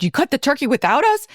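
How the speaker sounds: mock surprised. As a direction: feign shock at the turkey being cut. [name] arrives late, surprised.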